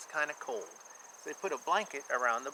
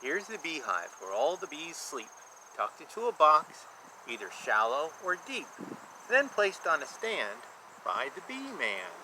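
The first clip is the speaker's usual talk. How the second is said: lilting rhymed picture-book read; sing-song storybook reading for a child; reading to children. A narrator's reading. Reads rhyming picture book aloud.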